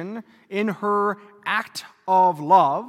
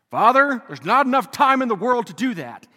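mock protest; 3 semitones higher, 7 dB louder than usual. Voicing a parishioner's objection.

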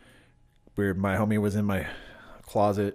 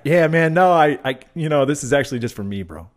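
raised, hedging mock excuse; loud, stumbling put-on reluctance; reluctant refusal; 6 semitones higher, 8 dB louder than usual. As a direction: imitate someone awkwardly declining to share. Acting reluctant stoner refusing to share.

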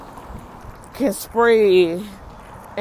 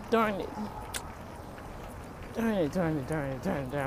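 frustrated; 4 semitones lower, 13 dB quieter, faster than usual. Real, not acted. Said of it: "missing the bus".

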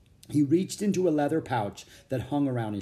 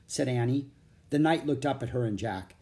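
plain reading voice

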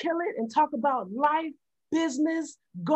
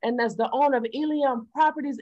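ordinary delivery

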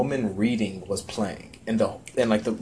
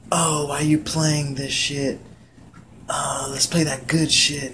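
slow, higher put-on complaint; mocking coworker impression; 4 semitones higher, slower than usual. Girl at work objecting to the music, mocking imitation. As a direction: drag it out, imitating a whiny complainer.

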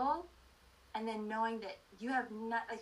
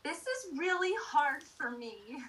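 retold cry to God; 7 semitones higher, 4 dB louder, slower than usual. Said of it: "yelled out to Heavenly Father".

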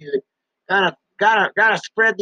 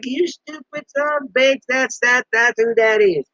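mock wife scolding; 6 semitones higher than usual. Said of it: "she's like".

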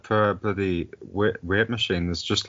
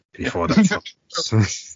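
slow, higher Irish accent; 5 semitones higher, slower than usual. Shown as a demonstration.

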